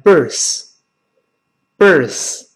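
birth with tongue tip between the teeth, slow. Shown as a demonstration. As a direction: say birth slowly, tongue poking out for th. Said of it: "吐出来之后变成".

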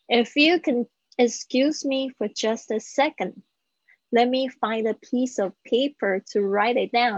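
"If you can excuse me" flowing, with the words run together; natural smooth English with every link; laughing lightly, then a fluent model; linked speech at full speed. Shown as a demonstration. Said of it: "有没有发现那几个连音非常棒".

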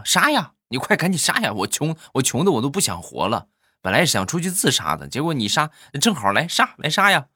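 high-pitched, shameless daring; mock desperate goading. Acted goading of a dream robber. (模仿耍无赖，高声催促劫匪动手)

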